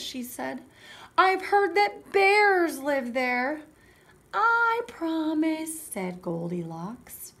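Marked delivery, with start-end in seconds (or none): 1.2-3.6 high, loud, drawn-out warning; cautioning mother. Acted. Mother warning Goldilocks about bears, dramatic caution. 4.3-5.8 put-on high, loud, slow little-girl promise; Goldilocks promising. Goldilocks promising her mother, sing-out little-girl voice.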